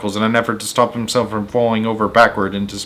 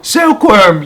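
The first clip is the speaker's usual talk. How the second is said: much higher, louder urgent call; yelling; 9 semitones higher, 6 dB louder than usual. Acted. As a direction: shout across the deck for help. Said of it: "yelled James".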